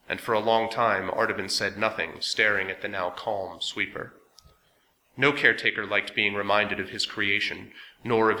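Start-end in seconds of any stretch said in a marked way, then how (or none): none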